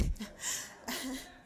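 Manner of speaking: brief breathy laugh; short self-conscious laugh. Real, not acted. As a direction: laugh off the threat of tears. Laughs off the urge to cry.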